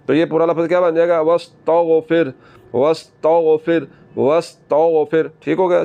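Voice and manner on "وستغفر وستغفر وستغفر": وستغفر slow, whistling s and heavy gh; slow recitation drill; whistling s and heavy ghain. Shown as a demonstration. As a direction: say وستغفر slowly, hiss the s, thicken the gh. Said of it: "سیٹی کے بعد غین کو موٹا".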